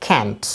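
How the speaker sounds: can't with a short flat a, American style. Shown as a demonstration. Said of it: "in American pronunciation it is pronounced".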